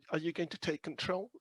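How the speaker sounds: normal talking voice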